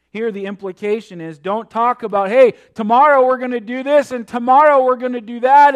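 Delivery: preaching at full force. Acted. Preaching on the verse.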